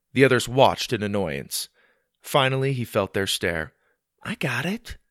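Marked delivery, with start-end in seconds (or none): 4.2-5.0 put-on young boy. Boy answers grandpa.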